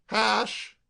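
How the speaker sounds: loud call toward the house. A narrator's reading. Sam calling for Hash from the door, loud. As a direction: call the manservant. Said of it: "he called".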